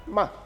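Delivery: "Ma" short and quick, without tone movement; neutral tone. Shown as a demonstration. Teacher showing the fifth tone, "Ma" quick and neutral. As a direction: say "Ma" briefly and lightly. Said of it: "le ton neutre".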